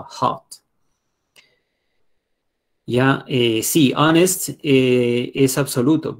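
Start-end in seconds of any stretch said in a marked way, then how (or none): 0.0-0.4 "Hot" with H like a light Spanish J; H sounded in "Hot", not too strong; soft J-like H. Shown as a demonstration. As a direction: open "Hot" on a gentle Spanish jota sound. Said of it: "como una J del español".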